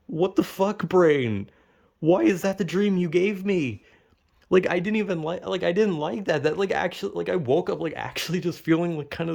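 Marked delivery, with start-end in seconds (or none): 0.1-3.8 higher-pitched, exasperated demand; incredulous complaint. Retold or imitated. Dreamer berates own brain over the dream, exasperated disbelief. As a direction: scold in baffled outrage.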